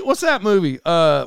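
ordinary talk, nothing marked

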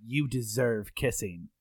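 low-key, drawn-out come-on; flirting Rhett Butler. Acted. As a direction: deliver a seductive movie quote, slowly and softly. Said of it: "I'm gonna say a Rhett Butler quote".

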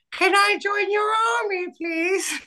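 very high, squeaky little mutant voice; a tiny eager squeak asking to join; little mutant guy. Retold or imitated. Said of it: "he's like".

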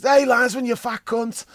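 loud, high mock yell at the linesman; imitating a fan's shout. Retelling the lad in the next seat heckling the linesman, loud mock shout. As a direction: bellow it from the stands like the young fan. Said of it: "he stood up and he shouts".

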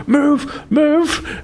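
high yelled warning; shouting move. Retold or imitated. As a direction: yell to clear the path. Rushing downhill at old ladies.

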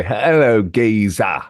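a hint of a London geezer accent; playful imitation of a South London bloke; mock English accent. American host aping a Londoner's accent. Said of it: "aping the English accent".

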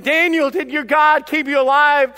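loud, high call to Daniel; crying out. Acted. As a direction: call out anxiously as a king. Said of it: "Nebuchadnezzar cried out to him".

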